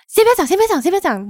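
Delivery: mock-quote Jenny cutting you off; imitating Jenny. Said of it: "他会stop着我来讲". (模仿朋友叫停，语调偏高)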